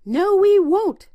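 high, loud, slow pig refusal; pigs refusing. A narrator's reading. Two pigs refusing the wolf, high and loud. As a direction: shout it back, high and defiant. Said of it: "said the pigs".

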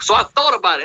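ordinary talk

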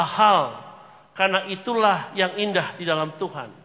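loud, slow proclaiming of scripture; preaching scripture. Acted. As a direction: read the verses out as a preacher at full force. Reads the scripture passage aloud.

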